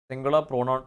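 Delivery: normal talking voice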